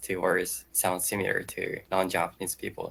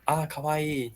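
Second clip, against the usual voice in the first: put-on Japanese girls' kawaii; 6 semitones higher, slower than usual. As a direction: coo it like a girl gushing. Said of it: "most girls in Japan tend to use the word".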